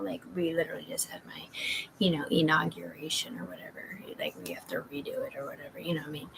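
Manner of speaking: hushed, breathy-free whisper, no voice at all; whispered aside. Real, not acted. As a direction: lean in and whisper it like a secret. Secretive aside to viewers.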